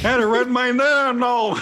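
very high, loud hammed-up American heckler; mock outraged American. Mock angry American resenting British actors.

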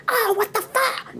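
high, drawn-out mock exasperation; mock angry outburst. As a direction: imitate him losing his temper, high and stretched out. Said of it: "se enoja".

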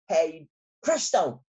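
ordinary delivery, nothing marked

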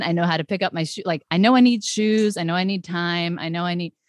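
ordinary delivery

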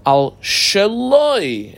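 shaloi drawn out very slowly and pitched high; a slow, high emphasis on "al shaloi"; stress on shaloi. Shown as a demonstration. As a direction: lean hard on "shaloi", stretching every sound. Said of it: "I double underline the shaloi".

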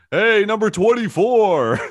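high, loud, drawn-out holler; imitated crowd heckle. Fan in the outfield hailing a player, imitated heckling shout. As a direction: call slowly across the outfield, imitating a fan. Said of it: "twenty people in the outfield, and he goes".

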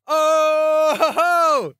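high drawn-out "oh-ho-ho"; high crowing laugh of delight; gleeful laugh. Real, not acted. Manager delighted by the fixtures, gleeful laugh.

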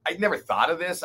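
usual speaking voice

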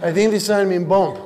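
put-on broken-English foreign accent; puzzled driver with a foreign accent. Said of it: "poor foreign drivers in our country".

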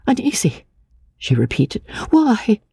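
put-on high, wary young woman's voice; suspicious young woman. A narrator's reading. Anne, startled by his worry, asks back in a high wary voice. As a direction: ask it sharply, full of suspicion. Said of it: "She looked up quickly and suspiciously".

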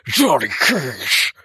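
announcer voice. Retold or imitated. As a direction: boom out the name like an arcade announcer. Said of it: "it was like".

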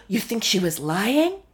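gasping, high, put-on actress voice; a hint of a hammed-up actress gasp; mock shocked actress. Mimicking Kyle's fake shock at a cast member. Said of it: "her actress face being like".

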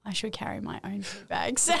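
mock guilty whisper; whispered conscience. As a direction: hiss it as his inner voice. Voicing his imagined conscience.

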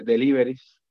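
ordinary talk

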